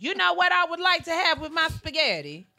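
hammed-up grandma voice; imitated grandma. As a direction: put on grandma announcing her wish. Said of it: "my grandma be like".

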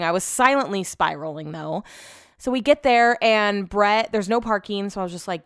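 ordinary delivery

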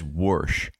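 "Warsh" with an R sound put in before the sh; imitating grandpa's "Warsh". Son-in-law mimicking how grandpa says a word, "Warsh" with an R. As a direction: say "Warsh" with a rural R, like "worsh". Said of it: "because he says "Warsh".

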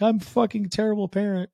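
high, whiny mock sob of a parent in a car; mock crying parent. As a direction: ham up a parent falling apart. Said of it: "crying, like".

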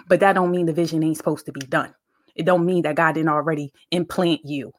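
ordinary talk

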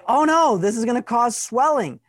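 panicked high voice; alarmed. Pretend. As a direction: sound the alarm, high and tense. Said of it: "it might say".

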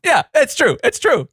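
squeaky-high sheepish agreement; jokey high voice. Pretend.

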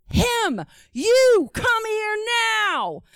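high, drawn-out angry summons; mock yell, stretch it out and drop on NOW; pissed-off warden. Pissed warden summoning the deaf dad, angry yell. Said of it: "He's pissed".

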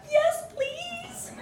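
very high, squeaky consent; mock-timid falsetto answer; tiny squeak. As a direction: squeak it out as if apprehensive. Squeaked consent to the dreaded cane.

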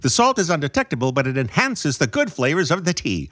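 fast-talking vintage newsman; 1940s news voice. Acted. Co-host reports a tea controversy, 1940s news voice. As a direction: deliver it like an old Hollywood news reporter. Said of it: "Say that six times fast in this voice".

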